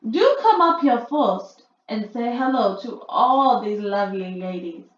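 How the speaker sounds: sweet as syrup, luring him in; syrupy sweet. A narrator's reading. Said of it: "soft and gentle and absolutely dripping with syrup".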